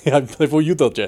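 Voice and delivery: echoing a mock Eastern European accent; laugh, then copy the put-on villain accent; Doctor Doom. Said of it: "I've always read him with an accent".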